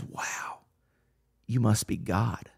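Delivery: hushed, awed dog voice, nearly whispered; a dog in quiet wonder at its owner. Retold or imitated. The dog looking up at its owner, in an awed hush. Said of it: "and that dog looks at you and says".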